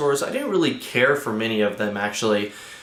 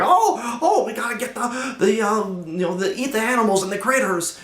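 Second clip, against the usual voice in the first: much higher, laughing mock hillbilly voice; hillbilly raptors; 10 semitones higher than usual. Reviewer mocking the hillbilly raptors, high and cackling. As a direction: play a giggly, dim country critter. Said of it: "these, like, hillbillies".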